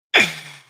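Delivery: loud high laugh. Real, not acted. Streamer laughing at her own story.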